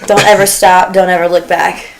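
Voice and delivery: ordinary talk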